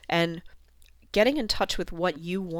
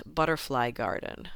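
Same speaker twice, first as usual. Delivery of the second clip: ordinary delivery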